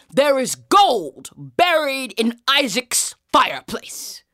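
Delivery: slow, loud, high proclamation; theatrical, drawn-out dream message; mysterious dream voice. Acted. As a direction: intone it slowly like an oracle. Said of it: "and the dream says".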